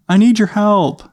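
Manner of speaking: put-on high little-kid voice calling out; Adam. Little boy asking dad upstairs for help, high child voice. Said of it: "And he goes, uh".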